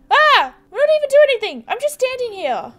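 high, loud, fast startled yelp; startled shout. Acted. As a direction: jump out of your skin and yell. Startled game character shouting.

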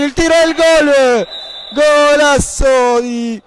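shouted, stretched goal call; breathless italian goal commentary. Real, not acted. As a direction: belt out the golasso. Live goal call in match.

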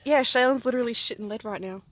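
ordinary talk

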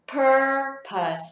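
loud, high PUR in "purpose", reduced "pus"; correct pronunciation. Shown as a demonstration. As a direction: model "purpose" as PUR-pəs. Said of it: "cách đọc đúng của từ này là". (phát âm chuẩn "purpose", âm đầu cao và mạnh)

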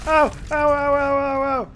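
high, loud string of ows; pained yelping. Pretend. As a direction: yelp as if being hurt. Character hurt in game.

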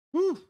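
loud high exhale; high whistle whew. Real, not acted. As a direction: blow out in disbelief. Exhale of disbelief.

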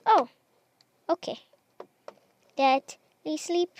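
ordinary talk, nothing marked

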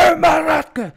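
high furious scream at a pupil; furious yelling teacher. Retold or imitated. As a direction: bellow it at full throat, losing it. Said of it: "huutaa ihan täyttä kurkkua". (opettaja huutaa täyttä kurkkua raivoissaan)

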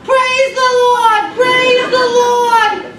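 very high, loud, slow sung-out praise with laughter; triumphant old lady singing praise; joyful screeched praise. Retold or imitated. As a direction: crow it back at him in delight. Woman answers, unfazed and joyful.

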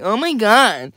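high, whiny mock gasp of offense; mock oversensitive outrage. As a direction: parody someone easily offended. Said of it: "Everybody's so sensitive about everything".

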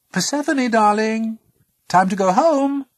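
high, loud mother's call. A narrator's reading. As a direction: call a daughter home across a distance. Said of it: "Demeter called out".

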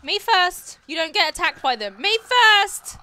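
loud, high-pitched insistence; shouting. Real, not acted. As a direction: yell it at the game, rising in force. Shouting at game characters.

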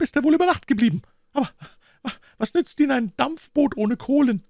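high-pitched, worried woman's character voice; worried boatman's wife. A narrator's reading. Wife tells a gentleman her husband is missing, high worried voice.

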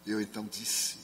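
ordinary talk, nothing marked

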